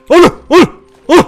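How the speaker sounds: high, loud alarmed calls. Real, not acted. Gamer as the wolf closes in, alarmed calls. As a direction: panic as it comes close.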